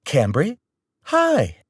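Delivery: high, bright sing-song greeting; breezy, pretending nothing was heard. Mom greeting the angry caller, sing-song. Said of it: "Mom said in a sing-song way".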